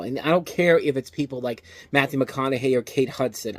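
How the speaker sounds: ordinary talk, nothing marked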